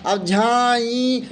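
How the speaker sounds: "झाई" stretched long and slow; drawn out झाई. Shown as a demonstration. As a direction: hold "झाई" for two beats. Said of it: "झाई को हम देर तक बोलते हैं". ("झाई" को लंबा खींचकर बोलें)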